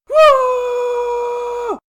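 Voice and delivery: high, loud startled yell; frightened scream. A narrator's reading. Lincoln screams in fear at the turtles. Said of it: "Lincoln screamed in fear".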